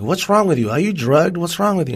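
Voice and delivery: mock-alarmed retelling of a baffled question; incredulous challenge. Passenger to the girl speaking in tongues, incredulous.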